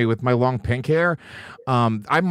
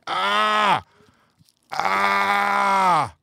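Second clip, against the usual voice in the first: long drawn-out "aah", higher pitched; mock pained cry; 4 semitones higher than usual. Co-host pretending to pull out his eye, drawn-out mock cry. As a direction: hold a hammed-up groan of effort.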